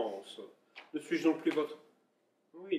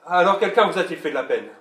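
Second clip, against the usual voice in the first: rising indignation; 8 dB louder than usual. Acted. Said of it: "calmez-vous".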